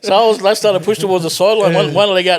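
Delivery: high, laughing retelling, slowing down; can barely get it out for laughing; laughing through story. Real, not acted. Laughing while retelling the game.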